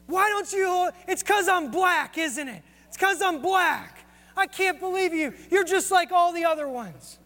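high, indignant retold tirade; woman accusing him of racism, high mock-furious voice; retold angry accusation. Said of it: "She started getting so angry at me".

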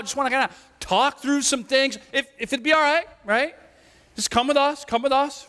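high, nervous, overly polite request; timid polite captain. Retold or imitated. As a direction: ask like an awkward nervous officer. Captain asks apostles timidly.

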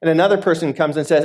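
usual speaking voice

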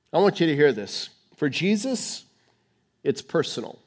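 normal talking voice

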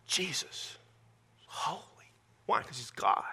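reverent whisper, barely voiced. Real, not acted. Preacher whispers to the congregation that Jesus is holy. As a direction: hush it like a secret.

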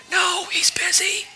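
put-on high, voiceless little-kid whisper; child's whisper. Child whispering to the boss on the phone. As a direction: hush it like a secret. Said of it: "whispered the child".

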